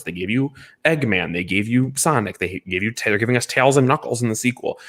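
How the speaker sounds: usual speaking voice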